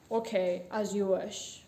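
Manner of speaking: low, obedient butcher voice; compliant butcher. A narrator's reading. Butcher submits to the bossy customer, compliant.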